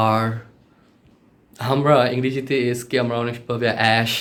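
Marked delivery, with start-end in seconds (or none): 0.1-0.2 "আর" pushed out with a long vowel; isolated, drawn-out "আর"; pushed letter R. Shown as a demonstration. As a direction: push through "আর" to the final R. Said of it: "এটা ধাক্কা হবে".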